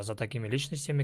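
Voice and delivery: ordinary delivery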